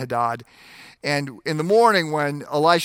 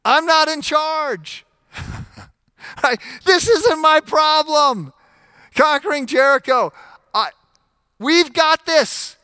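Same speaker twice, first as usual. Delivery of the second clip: relieved realization; 13 semitones higher, 7 dB louder than usual. Acted. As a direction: let it burst out as sudden relief, not in charge. Joshua's relieved realization voiced.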